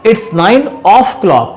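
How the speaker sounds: "of" pulled out slowly and stressed; slow, stressed teaching phrase. Shown as a demonstration. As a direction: lean on "of" to show the missing letters. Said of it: "अब यहाँ क्या missing है".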